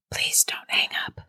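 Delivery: soft, voiceless plea; hushed, breath-only pleading; eerie whisper. A narrator's reading. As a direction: whisper it like a mysterious caller begging. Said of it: "still in a whisper".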